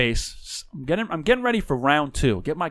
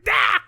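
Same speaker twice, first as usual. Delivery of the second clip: short, high, loud shriek; sudden high-pitched yelp of fright; imitated scream; 5 dB louder than usual. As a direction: mimic her startled scream. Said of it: "She's like".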